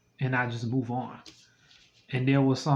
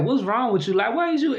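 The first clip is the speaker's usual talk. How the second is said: high, louder, shocked outrage; 9 semitones higher, 4 dB louder than usual. Real, not acted. Telling viewers about a crude request, shocked and louder. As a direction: pitch climbing high in disbelief.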